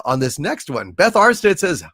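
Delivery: ordinary talk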